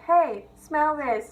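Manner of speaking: slow, teasing offer; drawn-out mock sweetness; playful teasing. Offering coughed-up ball to teacher.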